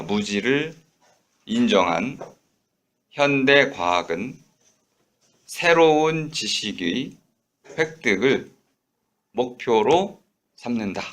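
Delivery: 무지를 / 인정한 / 현대 과학은, slow with clear pauses between chunks; phrased reading. Shown as a demonstration. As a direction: read it slowly, breaking after each phrase. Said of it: "끊어 읽으면 훨씬 쉽게 들립니다".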